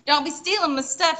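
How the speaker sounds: high-pitched playful indignation; comic indignant rant; mock outrage. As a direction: scold the little thief in mock fury. Mock outrage at a thieving mouse.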